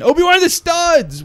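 high, loud alarmed call; urgent yell. Real, not acted. Player calling Obi-Wan back for studs, urgent yell. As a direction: holler at a teammate missing the loot.

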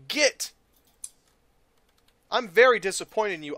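normal talking voice

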